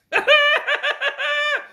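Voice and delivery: laughing hard and very high; a real squeal of laughter at himself; high shrieking laugh. Real, not acted. Laughs at the riddle's answer.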